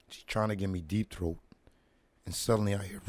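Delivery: ordinary talk, nothing marked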